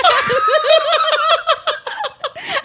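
shrieking with laughter; high helpless laughing shout; laughing hard. Real, not acted. Laughing at own failed attempt.

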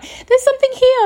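very high acted cry of fright; retold panic. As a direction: act out calling back in a panic. Retold frightened cry back to friend.

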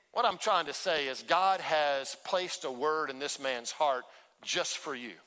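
ordinary talk, nothing marked

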